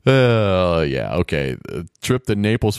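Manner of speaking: ordinary talk, nothing marked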